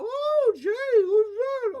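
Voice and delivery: high, slow, loud put-on voice; the stove voice. Sam teasing Jay everywhere they go, high and slow. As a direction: draw it out in a squeaky cartoon voice. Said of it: "Sam abuses the voice".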